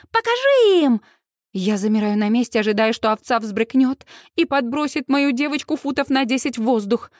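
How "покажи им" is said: high, excited egging-on; high-pitched fan cry; cheering shout. A narrator's reading. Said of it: "кричит Сьюз".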